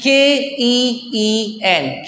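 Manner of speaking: slow letter-by-letter spelling; slow spelling, each letter apart; spelled out. Shown as a demonstration. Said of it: "this is called basically keen".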